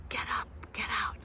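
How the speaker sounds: fast, soft whisper; a secret whisper to yourself; whispered mantra. Shown as a demonstration. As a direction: whisper it under your breath. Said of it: "you just say it over and over".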